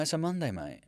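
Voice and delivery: lightly mock a shocked refusal; mock disbelief. Telling friends it's Monday, mildly incredulous. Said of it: "And I'm like".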